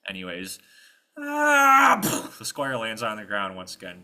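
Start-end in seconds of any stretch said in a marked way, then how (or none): 1.2-2.0 long, high, loud wail; falling scream. Acted. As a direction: wail all the way down. Squire falls to the ground.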